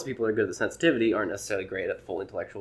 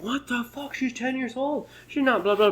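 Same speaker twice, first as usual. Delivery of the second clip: much higher, indignant mock outrage; outraged protester; slower than usual. Protester reacts to the joke with high mock outrage. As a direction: sputter like a shocked dad.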